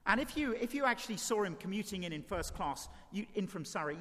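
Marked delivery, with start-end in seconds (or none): none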